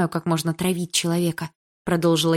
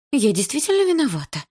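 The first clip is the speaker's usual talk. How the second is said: высоко, торопливо лепеча; пролепечи оправдание; 8 semitones higher, 3 dB louder than usual. A narrator's reading. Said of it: "пролепетала Лика". (испуганный высокий лепет)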